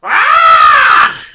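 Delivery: very high, very loud piercing scream; shrill scream. A narrator's reading. Missus Twit screams at the frog on her face. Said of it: "She screamed again".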